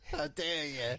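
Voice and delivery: a failed English accent attempt; mock English accent, lightly done; bad English accent. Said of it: "sounds like Daphne trying to do an English accent".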